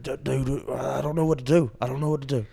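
lightly voiced worried friend; a mild, fretful imitation; Tuck, worried. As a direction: imitate a buddy at a loss, understated. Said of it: "you know, Tuck's worried".

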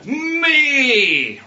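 high, loud self-cheering cry of a footballer; Jamie Tartt gloating. Retold or imitated. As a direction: shout me like a showboating striker. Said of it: "he goes".

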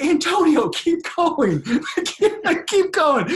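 high, loud laughing call; imitating an excited shout across the street. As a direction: cheer him on across traffic. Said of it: "and then I'm like".

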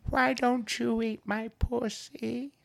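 high, slow demented granny; old lady voice. Retold or imitated. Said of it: "she goes".

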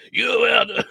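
mock German officer, loud and high; German authoritative. Co-host mimics the host's failed waiter as an authoritative German. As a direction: bark it in a hammed-up German accent. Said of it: "it was instantly very German authoritative".

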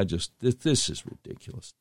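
hushed, disgusted under-breath aside; exasperated whisper. Real, not acted. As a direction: breathe it out in disbelief. Exasperated aside about the PAC.

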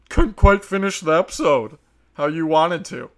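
fed-up comic rant; exasperated rant. Real, not acted. Ranting about post-credit scenes.

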